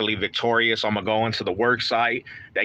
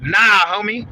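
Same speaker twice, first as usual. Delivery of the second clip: louder, higher "Nah, homie"; firm comeback to the critics; defiant retort; 7 semitones higher, 4 dB louder, slower than usual. Real, not acted. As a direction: push back hard on the haters. Retort to power trip claim.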